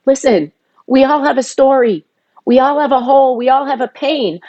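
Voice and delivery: insistent retold lecture; retold firm talk. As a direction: lay it down to him point by point. Retold firm talk to her brother.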